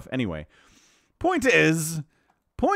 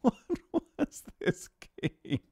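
words squeezed out between real laughs; laughing too hard to finish the sentence; breathless giggling disbelief; helpless laughter; 10 semitones higher, 5 dB quieter than usual. Real, not acted. Caster can't stop laughing.

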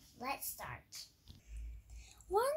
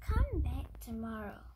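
put-on wise old sage; 7 dB louder than usual. Wise man answers giraffe.

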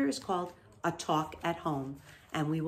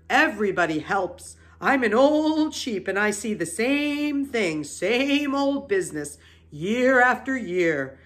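put-on an old know-it-all relentlessly piling on doom; old sheep; 5 semitones higher, 5 dB louder than usual. A narrator's reading. Old sheep voiced, describing butchering.

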